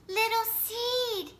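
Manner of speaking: worried little fox shouting for his friend. A narrator's reading. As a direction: stretch the name out in a high call. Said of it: "he shouted".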